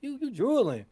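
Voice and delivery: mock disbelief. Stack recoiling in retold scene.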